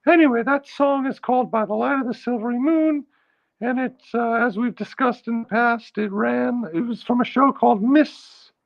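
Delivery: high falsetto chat; still in falsetto. Acted. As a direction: keep talking in the falsetto. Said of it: "I'll be talking like this".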